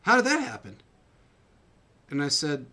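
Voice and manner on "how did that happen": high, loud astonished question; a wife amazed at a comeback win; wife's amazement. Retold or imitated. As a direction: imitate the wife's amazement, much higher and louder. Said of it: "And she goes".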